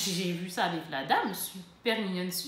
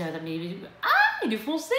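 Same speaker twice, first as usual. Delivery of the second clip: mock dismay; 4 dB louder than usual. Vlogger retelling her first look at the shade, mock dismay.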